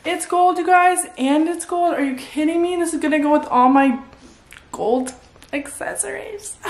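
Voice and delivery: high, thrilled gasp of delight; excited. Real, not acted. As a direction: gush over the find. Excited about gold heels.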